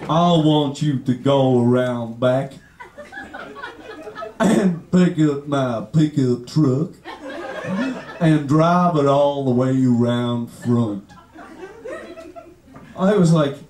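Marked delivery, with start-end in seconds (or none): 0.0-11.0 low, dragging Alabama drawl with long pauses; deep, slow mock-Alabama accent. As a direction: draw out each word like a slow Alabaman. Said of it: "the Alabama guy".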